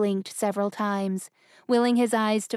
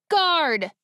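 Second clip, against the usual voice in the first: higher, louder urgent cry; shouted call; 7 semitones higher, 5 dB louder than usual. Acted. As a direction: call out across the deck. Prince calls for help.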